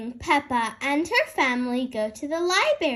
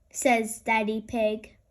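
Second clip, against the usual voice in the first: ordinary delivery, nothing marked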